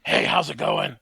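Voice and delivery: loud, laughing pigeon voice; talking animal. Acted. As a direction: greet like a silly bird. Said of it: "I'm official animal voice man".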